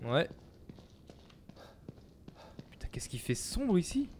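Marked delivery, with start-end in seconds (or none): 2.7-4.1 whispering. Real, not acted. Creeping through dark area.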